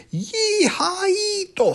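very high, slow cowboy whoop; long, drawn-out yee-haw; hollered. Acted. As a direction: whoop like a rodeo rider. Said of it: "hollered Scapito".

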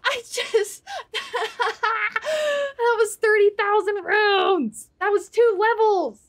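high, breaking voice between laughs and sobs; tearful laugh over the lost runes; choked-up laugh-cry. Real, not acted. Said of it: "I'm crying".

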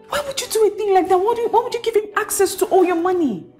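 fast, high-pitched exasperation; rapid, high, dismayed scolding. Acted. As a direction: rattle off an incredulous telling-off. Friend scolds the crying bride.

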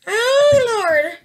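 high, loud oh Lord; a loud high burst of nerves; excited cry. Real, not acted. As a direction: call out to the Lord, thrilled and tense. Tense reveal moment.

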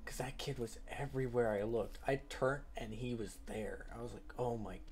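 hushed retelling, slowed; whisper. Real, not acted. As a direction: tell it in a low hush. Quiet retelling of scare.

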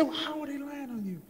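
high, astonished voice of a passerby; incredulous passerby. Acted. Passerby in the joke asks Satan, high and disbelieving. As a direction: squeak it out in disbelief.

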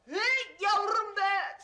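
slow, loud, stretched exclamation; drawn-out mock admiration.

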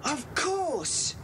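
of course, said high with sudden excitement; a bright burst of recognition; excited realisation. Acted. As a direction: it all clicks into place. Sudden realisation about the man.

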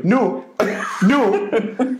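mock reluctant refusal; resisting refusal. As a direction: dig your heels in while being pushed. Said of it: "as I was pushing him".

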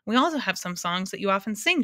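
usual speaking voice

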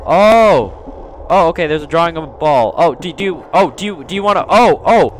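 excited realisation. Real, not acted. Player spotting the ghost girl's ball drawing, flustered excitement. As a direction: stumble over a sudden discovery.

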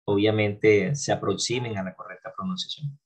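normal talking voice

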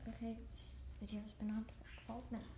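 soft whisper to herself; whispered aside. Real, not acted. Kid noticing the video length, whispered aside. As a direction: drop to a whisper mid-video.

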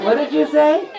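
high hen puppet, rising question; little red hen. Pretend. Hen checks what the children said, high puppet voice.

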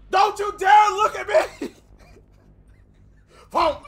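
high, loud laughing shriek; shrieking yell. Real, not acted. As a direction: scream it half laughing. Embarrassed after dying on stream.

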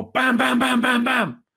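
high, rapid-fire "bam" bursts; imitated banging. Storyteller recreating sudden banging on the French window, high rapid bams. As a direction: mimic frantic pounding on the glass.

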